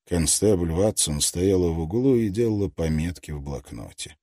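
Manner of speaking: plain reading voice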